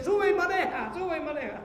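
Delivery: whisper-push like the devil egging him on; sly tempter urging him into the car; devil tempting. Acted. Devil tempting a drunk driver.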